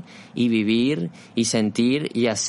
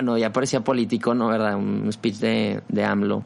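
ordinary talk, nothing marked

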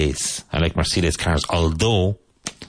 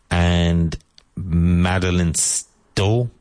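drawn-out uncertain name; much slower than usual. Real, not acted. Unsure of the actress's name.

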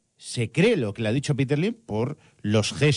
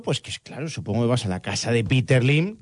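ordinary talk

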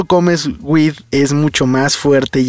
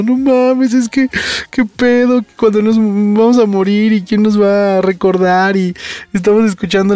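high teary whine from a bad trip; imitating crying whine; 6 semitones higher than usual. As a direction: replay your own drunk sobbing rant, hammed up. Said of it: "Y yo estaba llore y llore".

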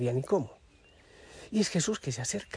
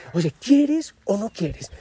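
louder, slow, pressing question; challenging demand; 4 semitones higher, 6 dB louder, much slower than usual. Real, not acted. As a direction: push them for an answer. Preacher challenges the listener.

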